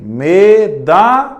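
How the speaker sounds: Medalha slow, the da leaned on; stress in medalha. Shown as a demonstration. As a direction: hit the da in Medalha. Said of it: "Aonde que é mais forte aqui". (Medalha com ênfase no da)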